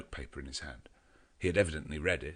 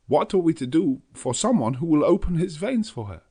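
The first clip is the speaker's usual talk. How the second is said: put-on high, loud, urgent old professor; anxious Dutch professor, pleading for help; Van Helsing; 9 semitones higher, 8 dB louder than usual. A narrator's reading. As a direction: press the danger with foreign urgency. Van Helsing voiced in narration.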